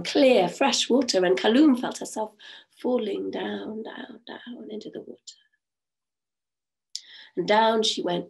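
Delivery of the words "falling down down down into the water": drifting down to a hush; fading whisper. A narrator's reading. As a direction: let the voice sink down with her. Sinking into the water.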